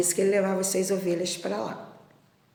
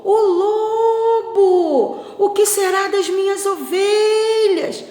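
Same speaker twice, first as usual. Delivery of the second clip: long, high, drawn-out shouts; stretched shepherd's yell, pitched way up; desperate cry for help; 13 semitones higher, 13 dB louder, much slower than usual. Acted. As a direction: call out across the mountain for help. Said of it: "começou a gritar".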